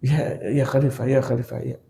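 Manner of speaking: small hushed frightened reply; low, soft, nervous stammer; timid, scared. Acted. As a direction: answer meekly, cowed by the caliph. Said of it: "takut dia".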